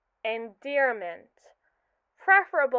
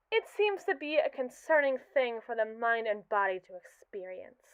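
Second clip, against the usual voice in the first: usual speaking voice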